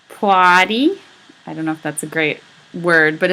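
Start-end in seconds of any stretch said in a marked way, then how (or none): 0.1-1.0 louder, Boston-flavoured plotty; Boston accent. Shown as a demonstration. Teacher demonstrating a Boston dialect, Boston accent. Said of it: "dialectical Boston variations".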